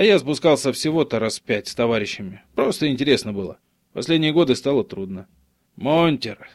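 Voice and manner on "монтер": "Монтер" stressed on the first syllable; "Монтер" with the weight up front, on "Мон". A narrator's reading. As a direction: hit the first syllable of "Монтер", let the second drop. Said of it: "слово он произнёс с ударением на первый слог".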